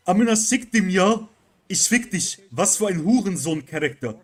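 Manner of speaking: loud, high German Turkish swearing; German Turkish rage accent. Retold or imitated. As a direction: yell it like a raging German Turkish gamer. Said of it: "Turkish, uh, Kenneck accent".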